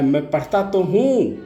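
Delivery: put-on scolded boy crying out to his angry father, a yell; child yelling after a beating. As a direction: cry it out like a beaten child protesting. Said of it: "वह बड़ी तेज़ से चिल्लाया".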